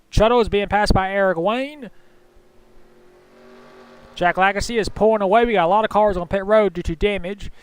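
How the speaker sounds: brisk race-call commentary, rising toward the finish; live play-by-play. Acted. Commentator calling the final laps to viewers, live play-by-play.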